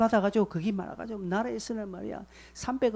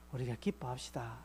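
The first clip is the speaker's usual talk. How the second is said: quoted elder; 7 semitones lower, 12 dB quieter than usual. Retold or imitated. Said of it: "장로님이 저에게".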